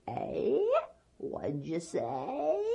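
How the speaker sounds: put-on soft old-granny query, rising at the end; lightly voiced hard-of-hearing grandma; pretending not to hear. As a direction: feign deafness, innocently asking. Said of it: "she pretended not to hear".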